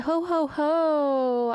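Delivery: mock laugh. Mock laugh reading listener message.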